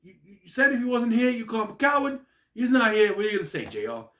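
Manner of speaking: hammed-up commentator challenging his partner; imitating Taz's commentary. Taz goading J R about a no-show, mock indignant. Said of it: "and Taz says".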